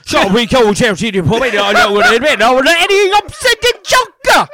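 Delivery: loud, hammed-up Cockney, climbing to a squeal; vicious Cockney accent. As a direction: do an over-the-top, garbled Cockney pub rant. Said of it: "the most vicious Cockney accent".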